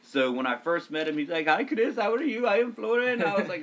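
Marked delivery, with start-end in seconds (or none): 1.5-3.2 high-pitched imitation accent that slips into Middle Eastern; botched Romanian accent. Romanian lifeguard introduces himself to a coworker, in a botched high accent. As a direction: put on a bad, high foreign accent. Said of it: "why did I just give a Middle Eastern accent".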